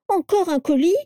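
high-pitched groaning question; moaning. A narrator's reading. Carla hears another parcel is coming, moaning. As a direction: whine it in dismay, pitched right up. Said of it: "gémit Carla".

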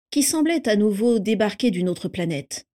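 ordinary delivery, nothing marked

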